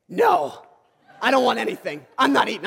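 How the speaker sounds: put-on childish huffy refusal; mock sulky I'm not eating. Refusing wife's offer sulkily.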